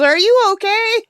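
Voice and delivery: high, loud squawky parrot imitation; imitating a parrot calling from the other room. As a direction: ask it the way the parrot would. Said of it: "She goes".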